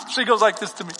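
normal talking voice

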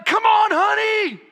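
high, loud sideline yell. Retold or imitated. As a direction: shout him down the court. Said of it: "we just started yelling".

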